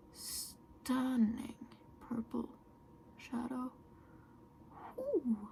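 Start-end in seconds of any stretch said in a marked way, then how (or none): none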